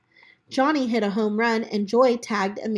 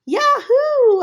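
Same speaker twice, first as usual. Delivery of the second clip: high, loud joyful yell; 9 dB louder than usual. Acted. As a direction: whoop it out with joy. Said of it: "I yelled".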